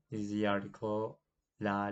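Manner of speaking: usual speaking voice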